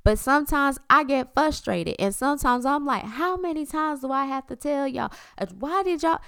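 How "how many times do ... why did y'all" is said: put-on exasperated, higher-pitched scolding of the kids; fussing mom. Mom fed up with her kids, exasperated fussing. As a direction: fuss at them like a worn-out mom. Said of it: "And I'm fussing".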